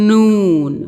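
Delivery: "नून" said loudly and clearly as a model; clear model word. Shown as a demonstration. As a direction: show how "नून" comes from the mouth. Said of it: "वो यूं निकलता है". ("नून" साफ़ और ज़ोर से)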